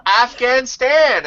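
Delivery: put-on high, slow, drawn-out child's answer; kid voice. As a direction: answer like an earnest little kid. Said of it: "I said".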